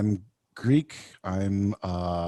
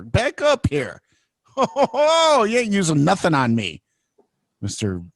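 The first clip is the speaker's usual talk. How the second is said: high, exaggerated backing-off protest; playful high-pitched indignation; mock alarm; 8 semitones higher, slower than usual. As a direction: fend them off in pretend shock. Mock alarm at suggestive remark.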